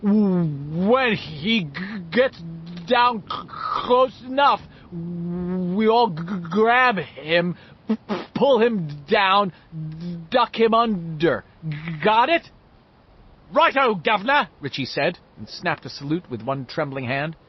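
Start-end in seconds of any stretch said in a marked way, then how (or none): 0.0-12.5 slow, loud, stammered plan; speaking loud, stuttering. A narrator's reading. Bill explaining the plan to friends, loud stutter. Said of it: "Speaking loud". 13.5-14.5 mock British. A narrator's reading. Richie mock-British salute.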